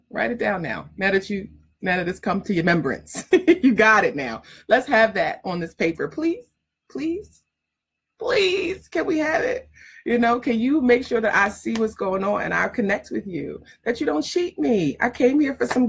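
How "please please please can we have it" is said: wheedling mock plea, much higher; mock pleading. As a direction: beg sweetly and teasingly, pitched way up. Begging students to write it.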